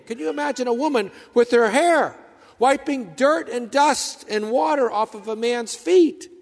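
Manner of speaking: high, loud impassioned preaching; fervent preacher painting the scene; preaching at full force. Acted. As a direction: build the story with rising fervour.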